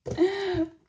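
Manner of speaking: high, bubbling laugh of joy; delighted laugh. Real, not acted. Delighted at parcel.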